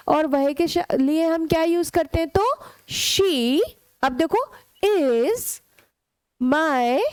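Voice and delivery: ordinary delivery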